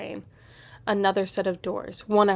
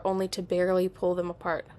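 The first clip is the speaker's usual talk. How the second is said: ordinary delivery, nothing marked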